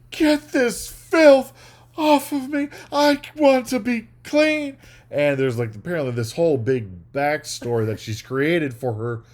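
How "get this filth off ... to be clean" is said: much higher, louder, slow overwrought plea; bad actress overdoing a soap commercial line; terrible melodramatic acting. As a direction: ham up a soap ad like a diva. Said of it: "Sophie does a terrible job of acting".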